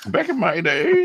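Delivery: put-on high, slow creaky old-man voice; hammed-up "back in my day"; mock old-timer. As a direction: play a doddering old man reminiscing.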